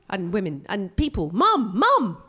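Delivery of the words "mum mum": calling out. Acted. Calling her mum.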